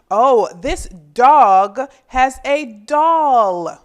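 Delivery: loud, slow delighted noticing; excited discovery. Acted. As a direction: exclaim as you spot the doll. Excited finding in picture.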